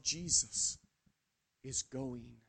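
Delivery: tearful hush. Acted. Peter weeping alone, a softly grieving murmur. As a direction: breathe it out as a mild weeping lament. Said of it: "As I wept, I cried to myself".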